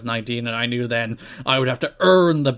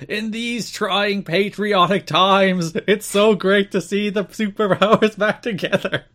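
slow, higher, solemn commentator voice; higher, slowed patriotic commentary, laughing at the end; mock patriotic announcer; 7 semitones higher, slower than usual. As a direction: ham up the flag-waving broadcaster. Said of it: "goes into full, like, pro-America mode".

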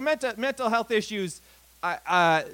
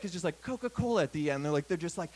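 ordinary talk

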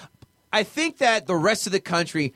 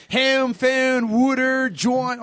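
mock Philly words; 7 semitones higher, much slower than usual. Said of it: "Philly accent".

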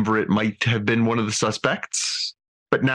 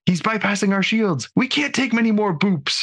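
much higher, urgent alarm; hammy stage actor; 12 semitones higher than usual. Retold or imitated. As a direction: ham up a panicked battle report. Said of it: "from Shax".

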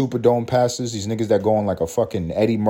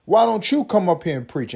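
imitating the pastor, raised pitch; hammed-up preacher calling her up; a hint of a pushy preacher; mock preacher invite; 7 semitones higher than usual. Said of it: "And his tone".

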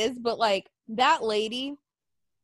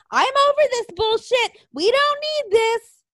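high-pitched, indignant imitation; mock tirade; 10 semitones higher, 4 dB louder than usual. Imitating lady ripping masks off Target display, mock tirade. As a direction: act out her fed-up outburst, pitched way up.

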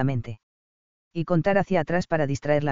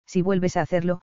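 ordinary delivery

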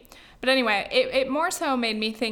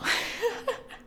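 giggle; 11 semitones higher, 4 dB quieter than usual. Real, not acted. Laughing at own accent.

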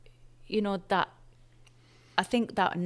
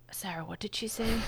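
hushed aside; 3 semitones higher, 6 dB quieter than usual. Retold or imitated. Nurses quietly asking Sarah about the Scouser, hushed aside. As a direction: imitate a puzzled, whispered aside.

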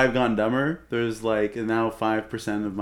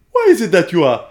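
loud, higher-pitched mock Kenyan accent; 5 semitones higher, 9 dB louder than usual. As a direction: put on a big Kenyan accent. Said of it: "like, a Kenyan sort of accent".

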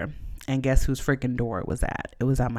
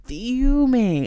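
fuming, said high and louder; 10 semitones higher, 7 dB louder than usual. Real, not acted. Telling about the neighbor, loud fuming emphasis. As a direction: spit the word out, still furious. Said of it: "I was fuming".

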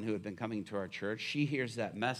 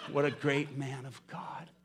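ordinary talk, nothing marked

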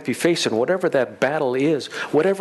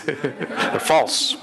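laughing. Real, not acted. Laughs about lying summits.

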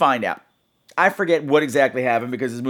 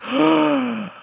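a sharp, high mock gasp of horror; shocked gasp; 10 semitones higher than usual. Reacting to the Ouija board naming the murderer, a high mock gasp. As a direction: gasp at the spooky reveal.

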